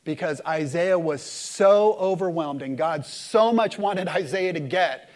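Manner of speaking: ordinary talk, nothing marked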